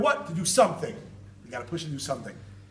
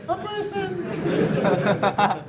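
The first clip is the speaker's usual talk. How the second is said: comically high, long-drawn echo; very high-pitched voice; 11 semitones higher, 3 dB louder, much slower than usual. Retold or imitated. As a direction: sing it out high and very slow. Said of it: "in a very high-pitched voice".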